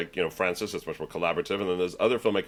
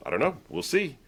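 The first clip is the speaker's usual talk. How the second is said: Walter impression; 8 semitones higher than usual. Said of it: "He goes".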